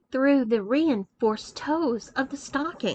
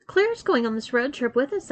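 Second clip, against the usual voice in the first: ordinary delivery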